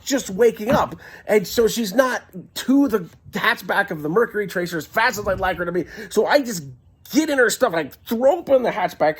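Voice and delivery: ordinary talk, nothing marked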